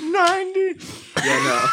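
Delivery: high giggling through words; laughing. Real, not acted. Laughing about roll.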